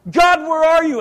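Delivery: loud, high plea flung upward; crying out. Acted. Preacher acting desperate people calling to God, crying out. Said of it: "these people are crying out to God".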